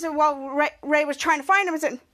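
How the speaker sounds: ordinary talk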